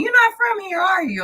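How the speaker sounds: high doubtful question; mock local voice. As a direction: imitate a skeptical local, high. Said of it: "the first thing they ask me".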